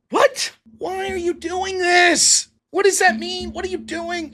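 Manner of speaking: high, slowed pleading questions; disbelief. Real, not acted. Streamer asks Connor why he posted this: high disbelief. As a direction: ask it in total bewilderment.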